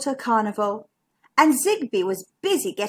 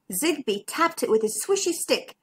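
plain reading voice

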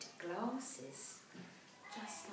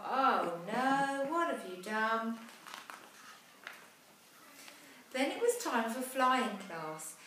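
put-on lively rhyming read-aloud for children; storybook narration; 3 semitones higher, 10 dB louder than usual. A narrator's reading. Parent reading a rhyming picture book aloud, lively. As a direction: tell the rhyme brightly to a child.

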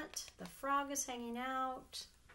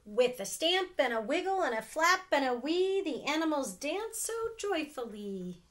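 louder, bouncy storybook narration; lively story reading; 4 semitones higher, 8 dB louder than usual. A narrator's reading. Aunt reading a picture book, loud and lively. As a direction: read it with bouncing energy.

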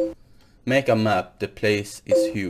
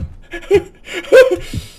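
nervous laugh; 6 dB louder than usual. Real, not acted. Nervous laugh after scare.

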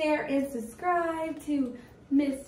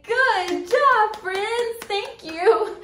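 excited praise; 7 semitones higher, 7 dB louder, slower than usual. Acted. Praising kids.

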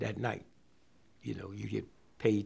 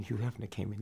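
normal talking voice

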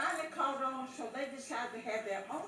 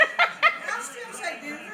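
excited; 11 semitones higher, 10 dB louder, slower than usual. Real, not acted. Insisting on her bet.

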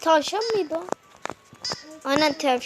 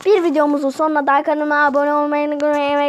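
loud outro; 10 dB louder than usual. Real, not acted. Channel outro call to action.

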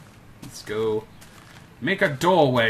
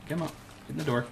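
ordinary talk

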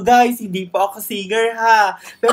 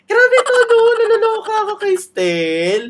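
much higher, loud giddy squeal; excited squeal; 12 semitones higher, 4 dB louder than usual. Real, not acted. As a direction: gush like a starstruck fan. Fangirling over singer.

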